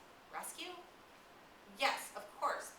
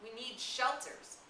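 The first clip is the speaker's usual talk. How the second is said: shouted in rage; 7 semitones higher, 6 dB louder, slower than usual. Acted. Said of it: "suddenly Jack shouted in rage".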